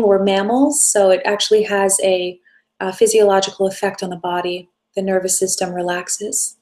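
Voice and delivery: ordinary talk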